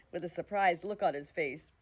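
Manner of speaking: plain reading voice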